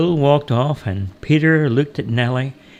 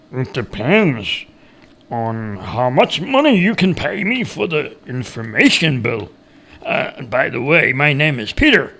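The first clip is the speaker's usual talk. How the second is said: cagey woodcutter. A narrator's reading. Woodcutter asking the stranger for money, cagey character voice. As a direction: draw it out like a canny hillbilly.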